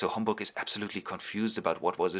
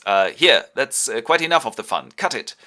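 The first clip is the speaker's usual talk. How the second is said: louder, brisk call to stop; mock-stern order; 9 dB louder than usual. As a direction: call a halt to the fun. Duke acted calling a halt.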